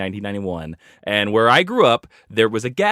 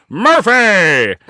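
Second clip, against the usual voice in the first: loud gruff shout of the name; shouted movie line; 7 semitones higher, 8 dB louder than usual. Retold or imitated. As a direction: bark it like the action hero. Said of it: "he turns to the camera and goes".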